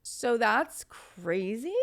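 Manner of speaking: drawn-out, stunned question; slow disbelief. Retold or imitated. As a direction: let the disbelief stretch out. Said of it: "reacting in disbelief".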